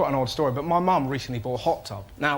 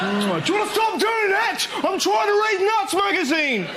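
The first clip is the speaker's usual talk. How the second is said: outraged passenger shout. Pretend. Passenger yelling at kissing men on plane, high outraged shout. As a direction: scream at the couple to stop.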